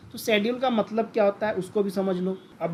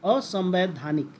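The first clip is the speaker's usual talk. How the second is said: "असंवैधानिक" slowly, each syllable clear; slow clear repetition; 3 semitones lower, slower than usual. Shown as a demonstration. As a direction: draw the word out for the class. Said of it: "दूसरा शब्द होता है". ("असंवैधानिक" धीरे-धीरे साफ़ बोलना)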